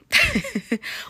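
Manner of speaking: short, high-pitched giggle; a quick burst of high giggles; light laugh. Real, not acted. Telling viewers how she handles stress, light laugh. Chuckles after admitting she travels.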